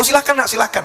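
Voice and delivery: high-pitched eager mother; welcoming mother. Retold or imitated. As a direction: invite the suitor in, voice lifted.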